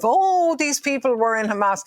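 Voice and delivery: sarcastic, high mock-innocent "Oh"; mocking quote. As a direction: parrot the excuse with a scornful lilt. Mocking the official announcement.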